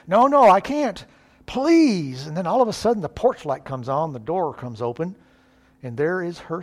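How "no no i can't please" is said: higher and louder, a frantic no; pleading refusal. Pretend. Girl refusing her boyfriend on the porch, louder pleading no. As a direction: push him off, begging him to stop.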